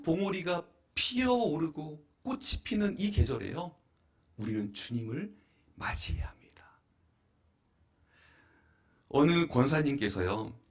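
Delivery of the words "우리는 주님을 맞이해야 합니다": hushed whispered ending; preacher hushing the close of a line. Real, not acted. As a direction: drop to a whisper for effect. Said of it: "whispered sermon line".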